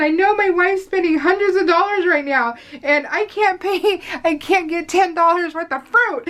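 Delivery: high, giggling retelling of his complaint; laughing through a quote. Retold or imitated. Wife laughing while quoting her husband's grocery complaint, giggling. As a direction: laugh the words out as you quote him.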